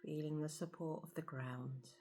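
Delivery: soft, hushed meditation voice with long pauses; guided meditation. Acted. Guide leading listeners to feel the breath and body, guided meditation. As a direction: settle them gently into stillness, softly.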